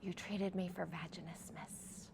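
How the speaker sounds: a quick secret whisper; whispered confidence. Retold or imitated. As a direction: lean in and whisper it so the son won't hear. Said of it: "she leans in closer and goes".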